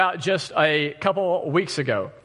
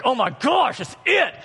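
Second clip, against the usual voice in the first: exasperated; 9 semitones higher than usual. Retold or imitated. Exasperated aside to wife.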